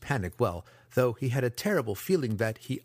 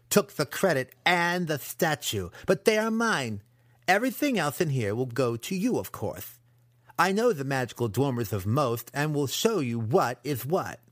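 higher-pitched, emphatic apprentice voice; possessive young apprentice; 4 semitones higher, 3 dB louder than usual. Acted. Apprentice claims the statue as his, possessive. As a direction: stake the claim as a cocky student.

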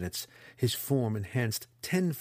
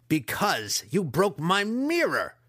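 loud, high, slow accusation; enraged shout; 9 semitones higher, 5 dB louder, slower than usual. A narrator's reading. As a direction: bellow the blame.

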